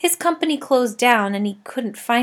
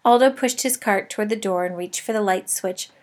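ordinary delivery

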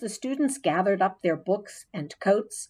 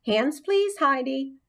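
weary; 7 semitones higher than usual. A narrator's reading. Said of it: "said Missus Brisbane wearily".

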